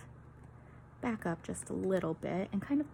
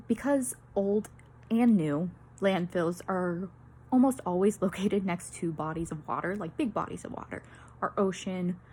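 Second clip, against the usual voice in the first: ordinary delivery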